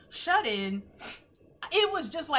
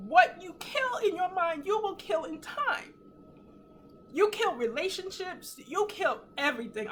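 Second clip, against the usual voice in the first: real crying, voice pitched high; choked up with tears, voice climbing high; tearful, emotional; 7 semitones higher than usual. Real, not acted. Repeating her revelation to viewers, moved to tears. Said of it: "I'm sorry to be emotional".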